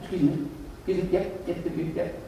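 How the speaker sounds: hushed, discreet interruption; acting out a soft, unshouting approach; very quiet. Diner interrupting a stranger's phone call, very quiet. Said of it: "Very quiet, no step, no shouting".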